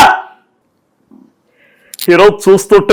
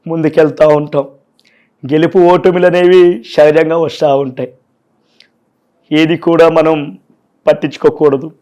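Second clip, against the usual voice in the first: Babu's voice; 4 semitones lower, 4 dB quieter than usual. Retold or imitated. Mimic the leader thanking voters after a loss, low and soft. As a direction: speak low and deliberate with long pauses. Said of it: "బాబు గారిది voice చేశారు".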